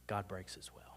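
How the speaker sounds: a tender hushed whisper. Acted. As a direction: breathe it out as a secret. Tender point about God's heartbreak.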